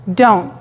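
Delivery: "don't" dicho con o británica; don't alargando la o; British don't. Shown as a demonstration. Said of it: "otra gente te va a decir simplemente". (don't británico)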